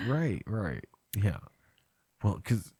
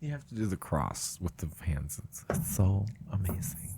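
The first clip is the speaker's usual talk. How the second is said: low, hushed, whispery professor voice; soft-spoken professor; 6 semitones lower, 9 dB quieter than usual. Acted. Co-host playing a soft-spoken professor, low and hushed. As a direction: speak very softly, dropping to a whisper. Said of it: "Really soft-spoken professor".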